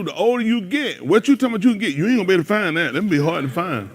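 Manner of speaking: ordinary talk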